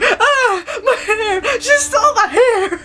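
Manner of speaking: high-pitched cartoon panic; mock wailing. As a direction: cry out like a robbed cartoon character. Voices Tetsuya wailing over stolen hair.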